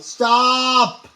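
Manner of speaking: "Stop." shouted loud and high with a falling end; shouting. Real, not acted. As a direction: yell "Stop." to make him quit. Child shouting at teasing dad.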